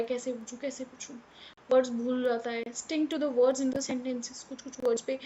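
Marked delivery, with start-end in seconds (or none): 0.0-1.1 softer, unsure murmur; hesitant mumble. Shown as a demonstration. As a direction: mutter it as a confused child. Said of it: "अपने doubts नहीं पूछ पा रहा है". (उलझन में धीमे से बुदबुदाना)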